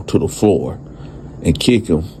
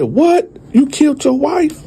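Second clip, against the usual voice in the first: high, stunned outcry of disbelief; shocked disbelief. Retold or imitated. Hearing an old man admit his wife died, shocked high. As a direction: gasp it out like you can't believe it. Teller reacts to confession.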